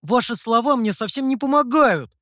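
rude retort. A narrator's reading. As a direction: answer back rudely. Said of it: "грубо ответил Сяо Ту".

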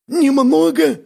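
high, loud incredulous echo; indignant outburst. A narrator's reading. Бяка stung by the word "немного", indignant. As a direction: flare up, voice shooting high. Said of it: "вскинулся Бяка".

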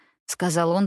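ordinary delivery, nothing marked

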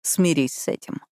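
lightly voiced man echoing her retort; a hint of his voice, mid-pitched and falling; male character's retort. A narrator's reading. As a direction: hand the line back in an understated male voice. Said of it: "ответил он".

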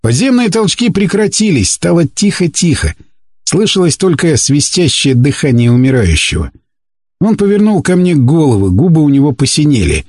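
ordinary delivery, nothing marked

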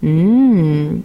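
sly teasing. A narrator's reading. Sister taunting narrator over ice cream, sly and smug. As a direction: tease your sister with every "مم". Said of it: "بكل خبث وتقول".

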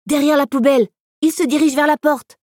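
high, excited puppy voice; urgent, high-pitched little pup; puppy yelping. A narrator's reading. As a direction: raise the alarm in a high yelp. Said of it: "glapit Lucky".

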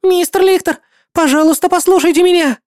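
high, loud, fast pleading defence; a young girl standing her ground, shouting; girl defends her friend to the paladin, loudly and high; desperate girl shouting. Acted. Kira begs the paladin.

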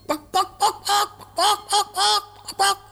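high clucking like a hen; chicken clucking. Acted. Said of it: "so fühlt man sich als Huhn".

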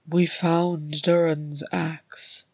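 slow, hollow voice with nothing left in it; hollow, empty tone. Acted. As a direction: say it emptied out, numb. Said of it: "in a hollow, empty tones".

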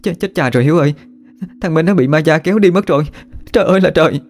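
high, panicked wail; tearful, high-pitched lament; sobbing outburst. A narrator's reading. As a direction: wail in horror to a friend. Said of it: "òa khóc lên nức nở".

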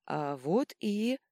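slow, high, left hanging; bewildered. A narrator's reading. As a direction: begin announcing, then stop in confusion. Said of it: "Жужжин растерянно замолчал". (растерянно, обрывая фразу)

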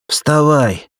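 high, lightly lisped order; lisping command. A narrator's reading. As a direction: call out ВСТАВАЙ with a slight lisp. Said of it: "прошепелявил Валерик".

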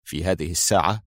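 low, soft note of surprise; astonished. A narrator's reading. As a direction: question it in hushed, mild astonishment. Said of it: "قال موشي في دهشة".

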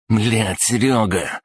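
high-pitched dismayed groan of words; doomed muttering. A narrator's reading. Смуглый to the mate who talked, doomed and dismayed. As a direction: let it out as a helpless lament. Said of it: "обречённо пробормотал Смуглый".

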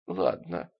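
low, soft, lightly croaked assent; subdued, slightly husky; hoarse croak. A narrator's reading. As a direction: give in with a faint croak. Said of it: "прохрипела она".

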